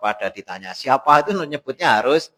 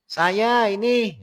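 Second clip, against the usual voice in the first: slow, high drawn-out "Saya ini"; vague reply; 9 semitones higher, much slower than usual. Retold or imitated. Guest answers vaguely, slow and high. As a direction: stretch out "Saya ini" lazily. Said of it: "Atau kalau kita".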